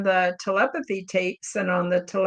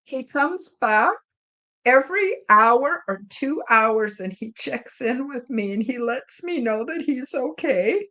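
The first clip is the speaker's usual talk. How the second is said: real tearful voice, pitched up; crying; 4 semitones higher than usual. Real, not acted. Owner telling how her cat now checks in, crying. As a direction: push the words out through tears. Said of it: "this is causing me to cry".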